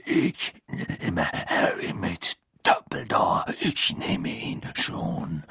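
very slow, low, breathy rasp; slow rough voice. A narrator's reading. Man offering Dumbledore to take Harry, slow rasp. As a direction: draw the words out in a husky rasp.

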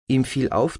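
usual speaking voice

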